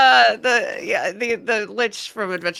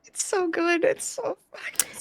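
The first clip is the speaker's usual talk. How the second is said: excited squeal; 13 semitones higher than usual. Real, not acted. Squealing over the dog demo.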